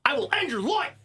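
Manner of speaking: loud, high mock-menacing outburst; hammed-up threatening yell; mock threat. Mock threat topping co-host's murder threat.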